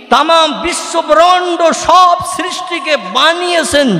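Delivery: high, ringing proclamation; forceful preaching. Acted. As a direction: declaim the Creator's greatness. (জোরালো চড়া গলায় ঘোষণা)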